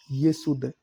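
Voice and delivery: ordinary talk, nothing marked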